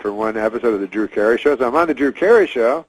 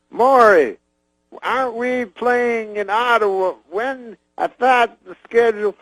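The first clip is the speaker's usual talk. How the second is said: much higher, slow Cosby voice; Cosby asking his manager about Ottawa, high and slow; Bill Cosby impression; 10 semitones higher, slower than usual. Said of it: "he goes".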